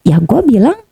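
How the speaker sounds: high-pitched reenactment of a back-and-forth; animated retold exchange. Acting out the scheming phone exchange.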